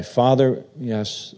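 normal talking voice

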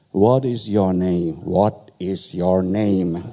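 slowed right down, each word spelled out for a foreigner; slow deliberate asking. Retold or imitated. Slowly repeating the question to the barber. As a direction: ask it slowly to the barber who doesn't understand.

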